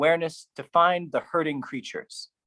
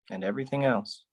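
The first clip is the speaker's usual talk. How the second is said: "everything" dropped softer; softer echo; 3 semitones lower, 5 dB quieter, faster than usual. Acted. Poet echoing the line softly. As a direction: let "And everything else" fall away quietly. Repeated line, second take.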